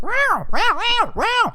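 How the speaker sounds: very high meowing; high squeaky mock cat meows; cat noises. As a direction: fake cat meow to lure it. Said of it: "and then I start making cat noises".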